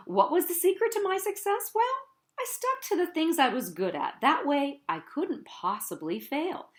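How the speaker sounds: ordinary delivery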